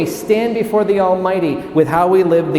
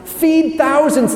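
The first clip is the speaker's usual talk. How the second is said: high, drawn-out wonder; acted amazement; 8 semitones higher, slower than usual. Crowd who saw Jesus feed thousands, high acted amazement. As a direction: marvel at it like an astonished onlooker.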